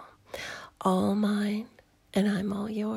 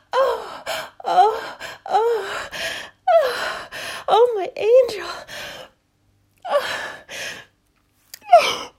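ecstatic moans; 11 semitones higher, 7 dB louder than usual. Acted. Lover moaning to partner after climax, high and teary. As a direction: ride the last waves of pleasure.

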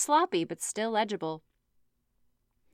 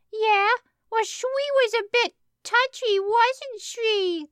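put-on high squeaky toddler voice; kid voice; 12 semitones higher, 4 dB louder, slower than usual. A narrator's reading. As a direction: pitch way up, slow and childish. Toddler character voice.

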